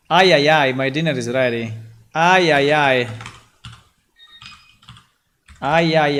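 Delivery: loud call across the house; yelling across the room. Real, not acted. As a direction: shout it to the next room. Dinner called, shouting back.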